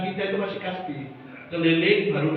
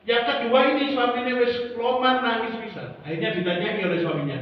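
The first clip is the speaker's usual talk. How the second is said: ordinary talk, nothing marked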